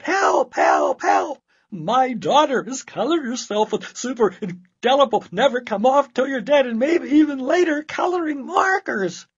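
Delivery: very high, loud panicked shouting to the doctor; frantic yell. Acted. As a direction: scream for help down the phone.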